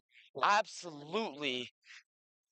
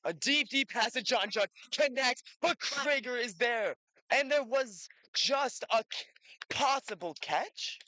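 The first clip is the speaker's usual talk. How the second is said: excited play-by-play; 8 semitones higher than usual. Real, not acted. Long pass, near catch.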